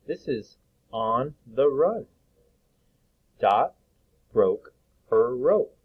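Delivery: slow, clear story reading; teacher reading a picture book aloud; reading to children. Acted. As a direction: read it slowly for young readers.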